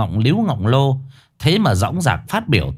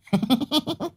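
high, soft gleeful cackle; high mocking giggle; cackling laugh; 6 dB quieter than usual. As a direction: cackle with glee. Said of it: "Thị cười khanh khách".

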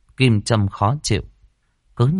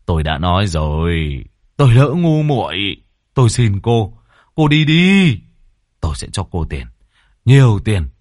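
louder, urgent begging; pleading landlord; 4 dB louder than usual. A narrator's reading. Landlord louder, begging the maid to leave. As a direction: beg her loudly to leave now.

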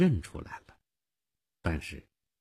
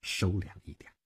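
ordinary delivery, nothing marked

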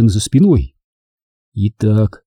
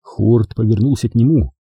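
usual speaking voice

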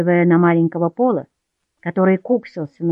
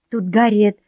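usual speaking voice

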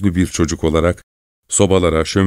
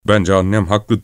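ordinary delivery

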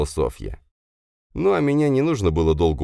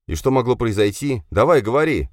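sharp shout; 7 semitones higher, 3 dB louder than usual. Acted. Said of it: "резко крикнул я".

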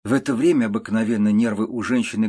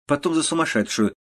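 ordinary delivery, nothing marked

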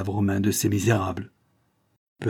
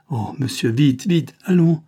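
frantic urging; 6 semitones higher, 5 dB louder than usual. A narrator's reading. Granddaughter urging, frantic about grandfather.

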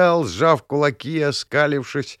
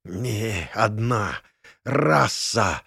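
hoarse wheeze; 3 semitones lower, much slower than usual. Acted. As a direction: force the words out hoarsely. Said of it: "прохрипел я".

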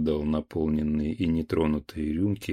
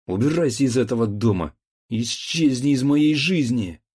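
loud and higher, building to a roar; furious drunken shout; 3 semitones higher, 7 dB louder than usual. A narrator's reading. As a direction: scream him out of the house. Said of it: "крикнул".

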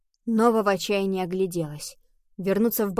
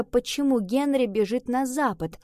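plain reading voice

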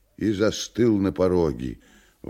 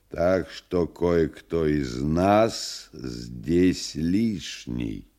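slow menacing devil; slower than usual. Acted. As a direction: lay out the verdict slowly. Woland pronounces sentence, slow.

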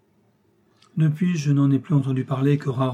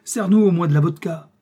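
loud, higher-pitched angry command; raised voice, bossing him about; shouted order; 5 semitones higher, 7 dB louder than usual. A narrator's reading. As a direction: cry out an order to a servant. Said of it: "lui cria le général".

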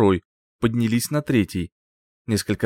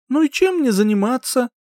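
bored whine; 13 semitones higher, 3 dB louder than usual. Acted. Bored heroine complains.

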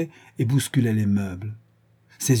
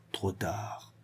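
whispered taunt; 3 semitones lower, 13 dB quieter than usual. Acted. Villain taunting his captive.

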